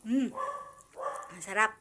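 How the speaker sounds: mouth full of hot food. Real, not acted. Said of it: "Napaso ang dila ko".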